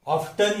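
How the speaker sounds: ऑफ्टन as OF-tun; the variant ऑफ्टन with t; T sounded. Shown as a demonstration. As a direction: clear t in ऑफ्टन. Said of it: "American English में".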